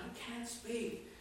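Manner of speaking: high, soft, slow put-on voice; mock helpless protest. Moses making excuses to God, mock helpless protest. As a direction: plead weakly, high and drawn out.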